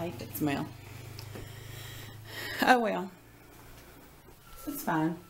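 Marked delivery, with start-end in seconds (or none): none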